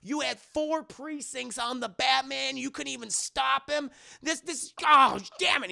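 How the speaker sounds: high-pitched angry rant; furious. Acted. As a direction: rant furiously, erupting at the end. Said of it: "he's fucking furious".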